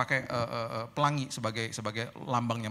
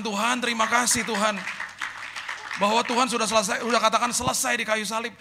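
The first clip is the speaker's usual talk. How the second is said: higher, louder proclaiming thanks; proclaiming declaration; 8 semitones higher, 5 dB louder than usual. Real, not acted. Preacher recalling his bold declaration to God, loud and high.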